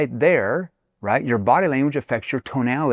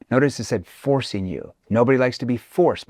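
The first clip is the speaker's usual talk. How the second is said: usual speaking voice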